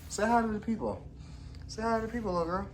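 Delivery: high, sing-song pet voice; sweet high voice for a little cat. Real, not acted. Talking to her cat.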